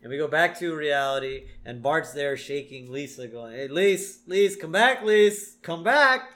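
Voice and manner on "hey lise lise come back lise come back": rising louder and higher with each call. Retold or imitated. Bart shaking Lisa, calling louder and higher. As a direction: call her back more urgently each time.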